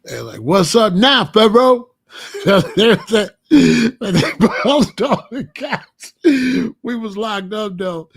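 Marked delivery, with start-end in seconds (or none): 0.4-1.9 loud, high jeering call across the cell; mocking taunt. Cellmates jeering at the lone vice lord, loud mocking taunt. 2.1-3.4 burst of laughter. Real, not acted. Laughing at the memory. 3.5-8.1 laughing through words. Real, not acted. Laughing while telling.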